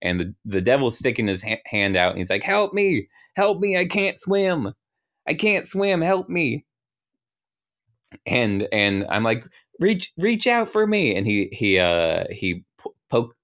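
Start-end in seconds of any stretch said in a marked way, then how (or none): 2.4-6.6 high-pitched cry of someone drowning; drowning devil's falsetto wail; mock panicked plea. Acted drowning devil begging for help. 9.8-11.1 high, strained rescue cry; hammed-up falsetto plea; mock urgent call. Acted call to drowning devil.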